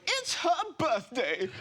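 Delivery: high, slow laughing "It's her birthday"; gentle explanation. Retold or imitated. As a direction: explain it sweetly as if obvious. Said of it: "this lady gently turned to me and went".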